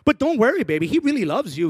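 imitating mom's sweet reassurance; mom comforting.

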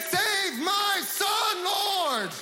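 very high, drawn-out desperate shout; crying out to God. Acted. Parent pleading with God for a son in trouble, crying out. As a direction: plead at the top of your voice, slowly. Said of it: "cry out to God and say".

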